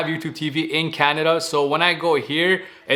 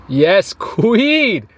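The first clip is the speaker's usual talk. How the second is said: excited; 4 semitones higher, 4 dB louder than usual. Real, not acted. Reacting to delicious food.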